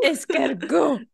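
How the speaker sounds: slow, laughing mock French accent; foreign accent in French. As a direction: ham up a French waiter voice. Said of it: "trying to speak French".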